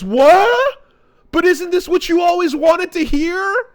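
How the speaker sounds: can't believe he said no; hammed-up indignant disbelief; mock shock. Sakura voiced, shocked at rejection.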